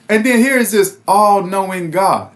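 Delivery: normal talking voice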